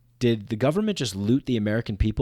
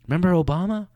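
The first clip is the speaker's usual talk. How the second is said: mock partisan voice; 6 semitones higher, faster than usual.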